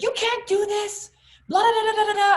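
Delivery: mocking angry manager. Saleswoman mimicking the manager scolding her, high mock nagging. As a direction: parody the manager's rant as empty noise. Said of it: "comes roaring over to me and she's like".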